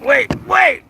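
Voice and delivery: terrified cry going down a slide; panicked yelling. Real, not acted. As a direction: shriek it in real fright.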